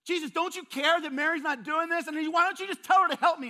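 high, loud exasperated complaint; frustrated Martha. Retold or imitated. Martha complaining to Jesus about Mary, loud and exasperated. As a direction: blow up at Jesus, shrill with grievance. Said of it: "And Martha's like".

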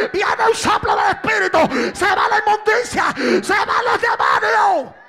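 loud, slow, repeated shouted pleas; full-force preaching. Acted. Pastor preaching at full force, shouting to church.

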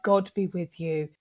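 low, soft solemn blessing; a nun's quiet send-off; solemn Julienne. Retold or imitated. Nun blessing the departing midwife, low and solemn. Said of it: "Julienne's like".

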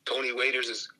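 ordinary delivery

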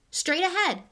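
high, loud call over the engine; shouted direction. Acted. Harry shouting directions to Ron in the car. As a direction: yell the way ahead.

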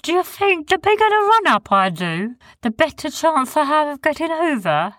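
Sniz's high voice. A narrator's reading. Sniz voiced in story.

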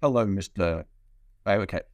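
ordinary delivery

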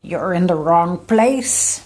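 lightly voiced giant croaking frog; loud, weighty frog-god pronouncement. A narrator's reading. Frog god to tiny stranger on the lily pad, croaking. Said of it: "croaking in the deepest, richest tones".

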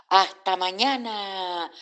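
high, drawn-out farewell call. A narrator's reading. New friends calling goodbye to Oreo, drawn-out call. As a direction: call it after someone leaving, stretched and high. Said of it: "le gritaban".

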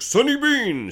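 high, drawn-out mock bellow; cave-man call. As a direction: imitate a caveman calling out a name. Imitates cave leader's only cry.

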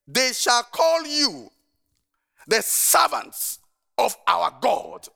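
slow, lifted, deliberate proclamation of scripture; slow scripture reading. Real, not acted. Pastor proclaiming a verse to the congregation, slow and solemn. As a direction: read each word out slowly and solemnly.

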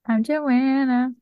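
slow, polished announcement voice; flight attendant. Acted. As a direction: stretch the name like a cabin greeting. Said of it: "Were you trying to sound like a flight attendant?".

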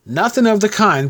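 high-pitched indignant objection; indignant protest. A narrator's reading. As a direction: protest with the voice jumping up. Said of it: "protested Tom indignantly".

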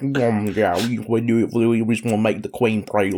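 mumbling mock Harry Kane; mushy mouth full of peanut butter. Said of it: "talks like he has peanut butter in his mouth".

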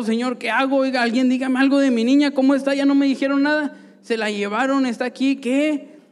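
worried father begs the guard, loud and frantic; desperate plea. Retold or imitated. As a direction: beg the guard for news of the baby. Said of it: "y yo desesperado".

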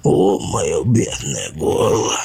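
very slow, hoarse pretend rasp; faked croak. Laban fakes a ruined throat for the bunnies, a very slow hoarse rasp. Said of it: "И он прохрипел".

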